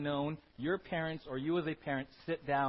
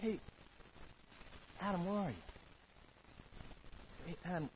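soft, gentle call fading to a hush; compassionate God; 11 dB quieter than usual. Acted. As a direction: coax him gently to confess. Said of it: "It was grace. It was compassion".

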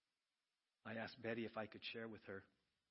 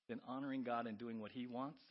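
ordinary delivery

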